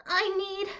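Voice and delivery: high, loud breathless plea; beg, voice shooting up; needy high voice. Acted. Begging the alpha, high and loud.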